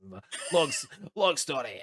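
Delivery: laughing through the words; a tipsy man chuckling at old memories; rambling with laughs. Acted. Drunk halfling rambles, laughing.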